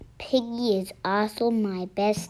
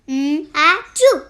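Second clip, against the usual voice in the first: sneeze; 4 semitones higher, 8 dB louder than usual. Acted. Second sneeze building.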